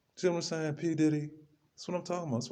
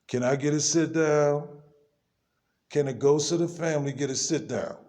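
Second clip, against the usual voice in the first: 1 loud appeal; 3 semitones higher, 4 dB louder than usual. Real, not acted. Loud demand for a sit-down.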